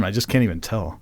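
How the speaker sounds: ordinary talk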